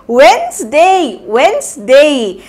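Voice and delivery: वेडनेसडे slowly as wenz-day, twice; repeat-after-me drill. Shown as a demonstration. Teacher leading the class to repeat वेडनेसडे. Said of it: "मेरे पीछे पीछे बोलिए".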